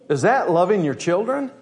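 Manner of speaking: ordinary talk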